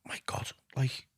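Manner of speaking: slow, breathy whisper; soft whispered inner thought; whispered reflection. Retold or imitated. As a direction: whisper it in disbelief. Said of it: "and think".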